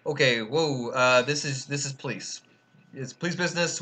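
usual speaking voice

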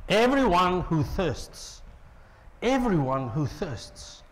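slow, deliberate invitation; slow emphasis. Acted. Preacher repeating the invitation slowly. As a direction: let each word land slowly. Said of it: "repeated slowly for emphasis".

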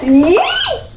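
high little yay; excited cheer. Real, not acted. Cheering before starting to cook.

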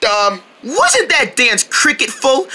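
usual speaking voice

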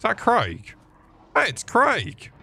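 high-pitched mock-delighted recognition; drawn-out, high exclamation; surprised recognition. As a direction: spot someone familiar, pitch leaping up. Spots Craig in the game.

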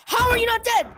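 loud, high-pitched disbelief; real fed-up gamer outburst; exasperated shout. Real, not acted. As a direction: yell at an enemy who will not go down. Exasperated at enemy surviving.

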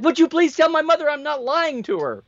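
high-pitched mock yell of exasperation; retold exasperated yell. Customer pleading with store clerk about her mom, high mock yell. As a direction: imitate an exasperated customer yelling, pitched well up. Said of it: "she yelled".

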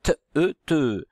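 short T, then E as "eu", blended into TE; sounding out. Shown as a demonstration. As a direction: sound out "T", "E", then join them as "teu". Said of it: "la syllabe te".

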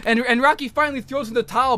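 ordinary talk

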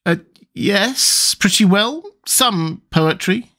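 unsure, hedging her answer; Alice hedging doubtfully about poetry. Acted. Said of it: "Alice said doubtfully".

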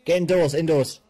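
high yelled commands; urgent shout. Real, not acted. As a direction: call out over combat. Shouting orders in firefight.

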